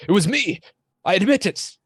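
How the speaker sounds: high, loud desperate outburst; yelled confession. Acted. Green captive confessing to his captors, high urgent yell. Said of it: "yells out".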